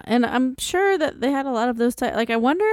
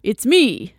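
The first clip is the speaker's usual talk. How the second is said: slightly wrong copied voice. Acted. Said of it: "I have the voice on".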